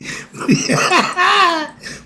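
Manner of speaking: a burst of high-pitched laughter. Real, not acted. Laughing at his own joke.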